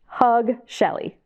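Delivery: plain reading voice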